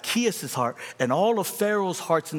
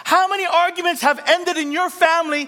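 climactic preaching; 13 semitones higher, 6 dB louder than usual. Real, not acted. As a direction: cry out the point at full force. Sermon climax.

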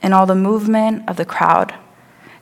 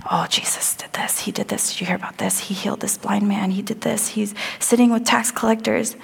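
hushed crowd whispering; soft gossiping whisper; 6 dB quieter than usual. Acted. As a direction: breathe it like overheard chatter. Crowd gossip imagined by blind man.

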